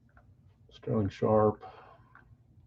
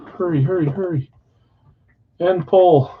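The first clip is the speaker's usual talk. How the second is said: quick urgent, higher pitch; urgent hurry; 6 semitones higher, 4 dB louder than usual. Real, not acted. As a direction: rush it before the vote changes. Rushing to close poll.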